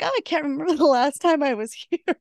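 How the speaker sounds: can't keep a straight face; laughing through it. Retold or imitated. Host retelling Wynonna's joke, laughing through it.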